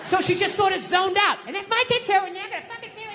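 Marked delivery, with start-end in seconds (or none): 1.5-3.1 high, scolding wife voice fading away; ranting wife. Retold or imitated. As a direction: imitate a tirade that recedes as you tune out. Wife's rant resumes while he zones out.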